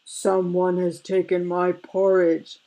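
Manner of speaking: lightly voiced bear, loud and put-on; big deep bellow. A narrator's reading. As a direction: bellow it mildly like an indignant papa bear. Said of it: "bellowed in his big, deep voice".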